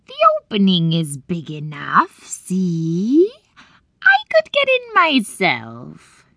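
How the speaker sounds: raspy hag whisper, rising; whispered witch voice. Acted. Witch lures Gretel.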